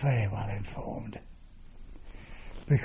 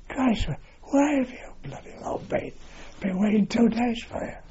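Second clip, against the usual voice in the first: a much higher, sharper voice for the griping Canadian wing commander; impatient Canadian commander; 10 semitones higher than usual. Retold or imitated. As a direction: bark it out like an irritated officer who has been kept waiting. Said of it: "The station commander was a Canadian".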